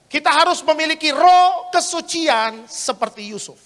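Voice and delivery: loud, high, slow declaration; preaching proclamation. Acted. Preacher declaring the third point about Joseph's purity, slow proclamation. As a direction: proclaim it word by word to the hall.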